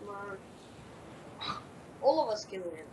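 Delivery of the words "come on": whispering. Real, not acted. Tense waiting for the win.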